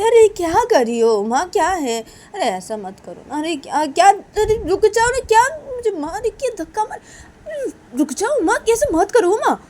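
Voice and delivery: very high, loud pleading; acted alarm, voice jumping up; flustered protest. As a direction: beg them to stop, high and urgent. Protests while being pushed.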